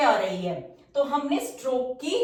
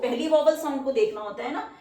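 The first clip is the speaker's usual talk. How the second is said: ordinary talk, nothing marked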